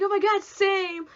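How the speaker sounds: high, loud, slow gushing; excited agreement. Retold or imitated. Friend Brianna squealing back, excited. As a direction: gush it back with a hammed-up squeal.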